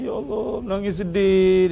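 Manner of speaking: mild mock weeping plea; imitated crying. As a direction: lightly imitate someone crying out to God. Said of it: "kita akan jumpa dengan orang yang menangis". (meniru tangisan sedih secara ringan)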